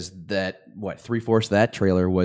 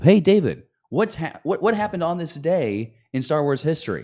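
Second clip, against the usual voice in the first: bright, higher intro voice; mock show intro; 8 semitones higher than usual. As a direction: hype it up like a host opener. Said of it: "we would say something along the lines of".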